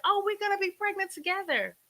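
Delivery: very high, gushing mock squeal; excited friends. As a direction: imitate thrilled callers, pitch way up. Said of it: "she was so excited".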